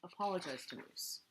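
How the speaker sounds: plain reading voice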